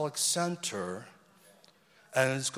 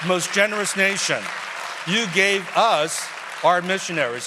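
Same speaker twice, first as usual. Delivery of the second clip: loud, higher-pitched preacher's exclamation; rousing praise; 4 semitones higher, 8 dB louder than usual. Real, not acted. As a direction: call it out to rally the congregation. Rousing thanks to the congregation.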